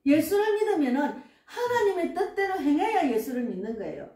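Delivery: ordinary talk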